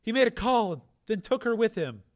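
put-on very high, loud pleading girl; terrified girl. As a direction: shriek in terror. Frightened girl.

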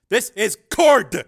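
slow, loud, high announcement; forceful commander's shout; shouted identification. Acted. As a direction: punch out each word down the phone. Barks orders into phone under attack.

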